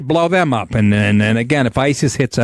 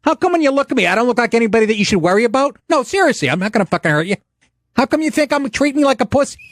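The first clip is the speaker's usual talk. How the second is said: higher mock mobster sneer; Christopher; 9 semitones higher, faster than usual. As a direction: needle the kid like a wiseguy. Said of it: "And then he goes".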